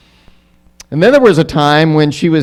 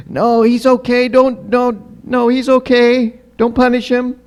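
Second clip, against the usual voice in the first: high-pitched imitation of a pleading sister; put-on child's protest, pitched way up; little sister pleading; 12 semitones higher than usual. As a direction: plead for a brother in a small, high voice. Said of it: "my sister would come to my defense".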